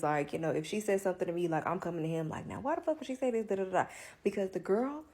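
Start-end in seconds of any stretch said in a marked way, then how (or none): 2.5-3.9 fast, exasperated retold demand; mock accusing question. To her man about the other girl, fast irritated demand. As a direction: throw the question at him, quick and fed up.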